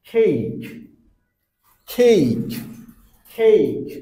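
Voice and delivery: cake with a strong aspirated kh at the start; aspirated English k. Shown as a demonstration. Teacher drilling students on aspirated k. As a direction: breathe out hard on the k of cake, like Bangla খ. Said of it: "First one".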